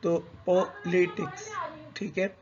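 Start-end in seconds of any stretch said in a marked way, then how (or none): 0.5-1.5 politics slowly split as po, li, tics; slow demonstration of each syllable in politics. Shown as a demonstration. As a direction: separate the three syllables of politics. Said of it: "there are three syllables in this word".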